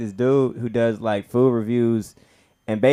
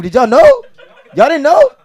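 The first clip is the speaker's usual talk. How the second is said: much higher, louder teasing shout; excited call-out; 12 dB louder than usual. Real, not acted. Comic teasing the audience about Big Groove, loud excited. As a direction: tease the crowd for not knowing.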